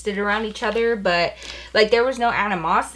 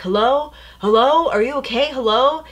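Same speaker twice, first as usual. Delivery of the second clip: raised, rising hellos; worried calling out; 5 semitones higher, 5 dB louder than usual. Retold or imitated. Boyfriend calling to her over the phone, imitated worried raised voice.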